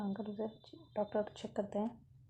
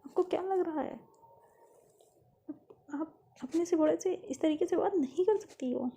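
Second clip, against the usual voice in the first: acted big-sister telling-off, pitch often raised; indignant scolding; 3 semitones higher than usual. Elder sister scolds Lovely for hurting Dad, indignant and raised.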